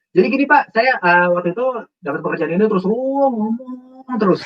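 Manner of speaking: high, rushed nonstop ramble; hammed-up breathless-paced interview answer; no pauses. Shown as a demonstration. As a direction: rattle on without any pause. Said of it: "harus ada jeda, gitu ya, nggak ada".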